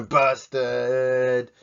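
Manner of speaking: loud teasing call; loud playful busted. Acted. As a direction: shout it out playfully. Teasing punchline after chant.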